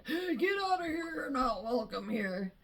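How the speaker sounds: a hint of a king's sharp dismissal; the old king turned cold, sending guests away; hostile king. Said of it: "he's like".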